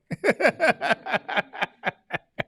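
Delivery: high loud burst of laughter; real laughter. Real, not acted. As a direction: laugh out at the joke. Laughing at own joke.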